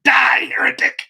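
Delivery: high, loud shouted condemnation; hammed-up outraged zealot's yell; mock zealous fury. Punchline, sudden condemnation of rival.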